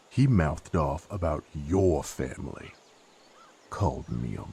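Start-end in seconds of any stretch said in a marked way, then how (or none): none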